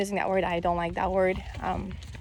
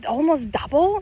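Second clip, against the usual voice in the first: higher, louder rising disbelief; incredulous question; 5 semitones higher, 5 dB louder than usual. Real, not acted. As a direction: ask it like you can't believe it. Incredulous question about weight gain.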